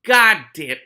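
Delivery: ordinary delivery, nothing marked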